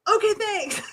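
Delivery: high-pitched, hurried-away reply; retold startled thanks. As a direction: act out thanking someone who just shouted at you. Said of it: "And I'm like".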